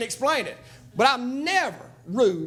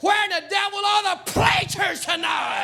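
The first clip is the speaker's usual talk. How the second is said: preaching at full force; 4 semitones higher than usual. Acted. Preacher rousing the church about real preachers, full-force preaching. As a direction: thunder it out to the church like a revival preacher.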